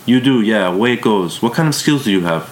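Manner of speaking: a hint of a strong German accent. A narrator's reading. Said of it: "She had a strong German accent".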